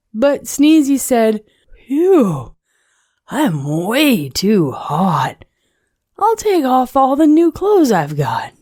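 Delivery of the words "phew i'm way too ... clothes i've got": lightly voiced snowman, puffing then higher; a hint of a too-hot snowman fanning off. A narrator's reading. Overheated snowman.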